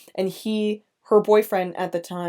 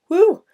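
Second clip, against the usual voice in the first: sudden high, loud whoop of release; overwhelmed high "whoo"; emotional "woo"; 12 semitones higher, 10 dB louder than usual. Real, not acted. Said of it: "Sorry, I'm getting emotional".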